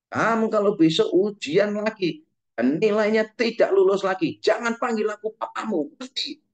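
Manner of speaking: furious father threatening his failing son. Retold or imitated. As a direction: threaten the son in mock rage. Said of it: "papanya yang marah setengah mati".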